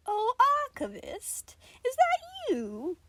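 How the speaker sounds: high, slow, sing-song voice; delighted Nicola. Acted. Nicola greets the Archivist with eerie delight, high and slow. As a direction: coo sweetly at the Archivist. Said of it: "Nicola seemed to giggle a bit".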